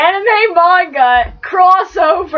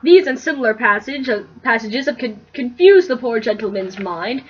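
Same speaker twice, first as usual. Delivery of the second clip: reading the fanfic aloud to her roommate, lightly narrated; easy storyteller read, a touch of mock grandeur; story reading; 2 semitones lower than usual. Reading a parody fanfic to a roommate, mild storybook voice.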